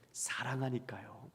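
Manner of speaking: near-whispered, slow and soft; hushed. Real, not acted. Preacher explaining God's restraint to congregation, hushed. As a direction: let the answer drop to a slow, tender hush. Hushed answer to own question.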